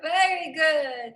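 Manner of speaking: slow, stretched very good; drawn-out praise. Real, not acted. Teacher praising the class, slowly stretched. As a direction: drag the praise out long. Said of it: "praising the class".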